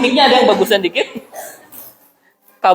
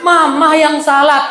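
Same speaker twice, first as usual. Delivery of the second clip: wife's voice, higher, slow and sweet; mock tender wife owning the blame; softened wife; 6 semitones higher, slower than usual. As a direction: play the wife gently giving in. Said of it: "Apa jawaban istri?".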